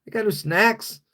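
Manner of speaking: very high squeaky mock dog voice; high cartoon dog voice; dog talking back. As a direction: voice the dog high and cheeky, telling his owner off. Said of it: "It's like".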